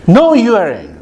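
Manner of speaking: imitated son's high-pitched contradiction; son insisting back. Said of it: "my son says".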